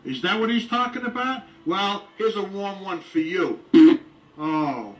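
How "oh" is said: normal talking voice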